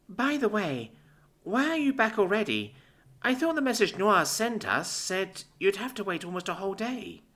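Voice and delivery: put-on bright, high girlish voice; young girl voice. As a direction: play her as a sweet, eager young woman. Narrator voices Curie, a young girl.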